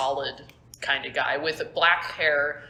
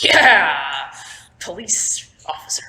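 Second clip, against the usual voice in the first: cop type; 5 dB louder, slower than usual. Acted. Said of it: "like one of those".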